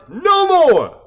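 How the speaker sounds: high, loud cry; rally shout. Acted. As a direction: cap the speech with a roar. Rally climax.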